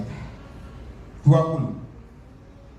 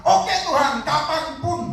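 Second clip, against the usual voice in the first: high, raised eager reply; eager readiness; 8 semitones higher, slower than usual. Acted. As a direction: answer the call readily, pitched well up. Acts out a ready reply to God.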